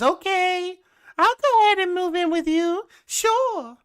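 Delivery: very high, singsong mock agreement; dippy falsetto imitation; Liz. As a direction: chirp it in a mocking high voice. Mocking Liz agreeing to move in.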